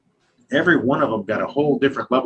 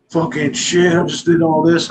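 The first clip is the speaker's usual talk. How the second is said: higher, louder mock exasperated complaint; acted-out frustrated outburst; retold frustration; 6 semitones higher, 4 dB louder than usual. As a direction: imitate someone upset at wasted work. Retold collaborator upset at breakage.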